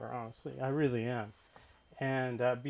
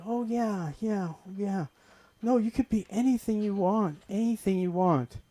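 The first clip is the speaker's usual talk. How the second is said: mom brushing off; 6 semitones higher than usual. Retold or imitated. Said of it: "And she said".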